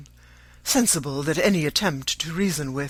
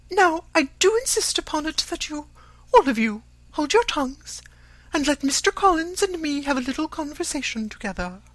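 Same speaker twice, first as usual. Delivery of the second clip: much higher and louder, a nagging self-pitying mother; scolding fretful mother; 13 semitones higher, 3 dB louder than usual. A narrator's reading. Mother scolding daughter for refusing marriage, much higher louder nagging. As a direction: scold her daughter high and aggrieved, full of nerves.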